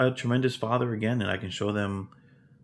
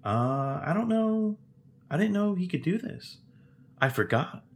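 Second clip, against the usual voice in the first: lightly voiced young son, higher and unsure; imitating his son; 9 semitones higher than usual. Father retelling what his son said, higher and unsure. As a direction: mimic the boy's puzzled shrug, pitched up. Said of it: "my own son was like".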